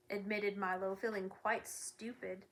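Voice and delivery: ordinary delivery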